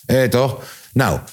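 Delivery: usual speaking voice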